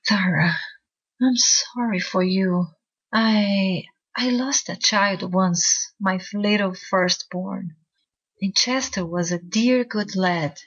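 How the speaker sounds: slow, tender and tearful; trembling voice. A narrator's reading. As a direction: comfort her through tears. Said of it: "her voice trembled as she said".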